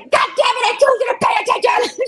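high, pissed scolding; angry yell. Retold or imitated. Mel scolding the player after his error, high and angry. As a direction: scold him like you warned him. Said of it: "and she's like".

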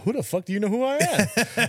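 high, jokey swagger; mock cocky. Answering the fawning girls, hammed-up cockiness. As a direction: play the suddenly famous hero. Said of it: "I'm like".

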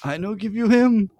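very high mock Dominican accent; Dominican coworker. Puzzling over the coworker's phrase, high mock Dominican accent. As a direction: squeak out Dominican broken English, imitating him. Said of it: "this is how he talks".

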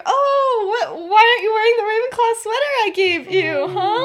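lilting, put-on questioning; teasing boyfriend. Boyfriend asks about the sweater he gave her, teasingly.

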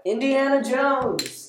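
loud, high, drawn-out outburst; outraged yell, stretched out; exasperated shout. Real, not acted. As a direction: shout it out slowly in disbelief. Shouted outrage over girlfriend's dislike.

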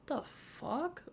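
high, hushed shocked reaction; stunned disbelief. Retold or imitated. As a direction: gasp it out in disbelief.

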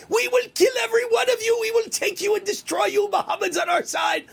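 high, shouted parody of a fanatic; mocking shouted threats. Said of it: "those are the people saying".

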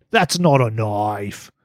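slow, stretched Aussie movie-quote voice; put-on Aussie drawl; attempted Australian accent. Shown as a demonstration. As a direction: draw it out in a mock Australian accent. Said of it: "let me hear your best Australian accent".

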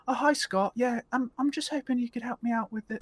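high, deferential caller voice; suddenly meek customer. Acted. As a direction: ask for help politely, pitched right up. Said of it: "they'd go from that to".

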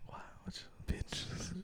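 barely audible comic whisper; whisper bit. Pretend. Whispering back to the co-host in a bit, hushed. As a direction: keep it fully whispered. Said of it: "the whisper bit".

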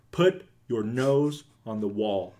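slow, spaced-out order; slow deliberate repeat. Retold or imitated. Dad repeating his order to confused girls, slow and deliberate. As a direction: repeat it slowly, word by word.

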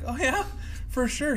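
high-pitched acted reply; eager mock agreement. Acting his reply to Louie.

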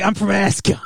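mock Australian accent; a rough stab at an Australian accent; Paul Anderson. Host guesses how the director talks, mock Australian accent. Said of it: "So Paul Anderson talks like".

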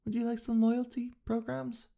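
chirpy cashier offering the program; upbeat clerk pitch. Retold or imitated. Mimicking the store clerk, chirpy and high. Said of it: "They go".

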